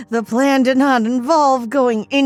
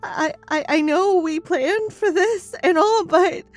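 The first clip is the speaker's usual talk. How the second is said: high, rising, louder panic; scared stammering; 7 dB louder than usual. A narrator's reading. Wife admitting fear despite their plan, panicky.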